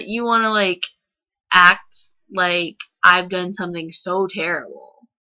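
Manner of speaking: ordinary talk, nothing marked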